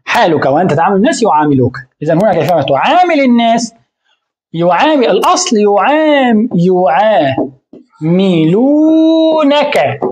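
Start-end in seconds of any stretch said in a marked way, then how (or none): 5.1-10.0 يعاملونك stretched out very slowly, every syllable and the final نون clear; original form drawn out. Shown as a demonstration. Teacher to class, spelling out the full يعاملونك slowly. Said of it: "ولكن حذفت النون".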